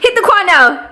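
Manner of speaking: yelling hit the quan at the party; cheering shout. Real, not acted. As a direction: egg the dancer on, building to a shout. Cheering a dancer on.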